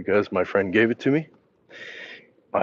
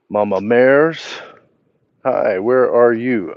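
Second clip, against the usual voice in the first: slow chat reading; 2 semitones higher, 6 dB louder, slower than usual. Real, not acted. Slowly reads out chat message.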